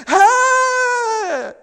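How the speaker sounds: high, loud cheerful "Hey!"; cheerleader whoop. Retold or imitated. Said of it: "she's like".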